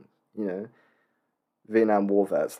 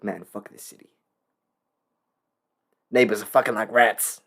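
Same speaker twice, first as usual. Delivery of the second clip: gritty narrator impression. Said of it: "and he's like".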